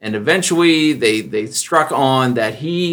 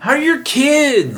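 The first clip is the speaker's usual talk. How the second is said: much higher, slow, gushing small talk; schmoozy small talk; 13 semitones higher, slower than usual. Retold or imitated. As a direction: oversell the friendliness. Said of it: "They're like".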